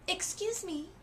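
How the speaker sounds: high, bright and cheery; a sweet old woman's sunny greeting; old woman greeting the father at the door, brightly. A narrator's reading. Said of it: "she said brightly".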